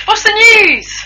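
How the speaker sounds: high, drawn-out mock voice. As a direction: sing out the question in a silly high voice. Jokey high-voiced question to co-host.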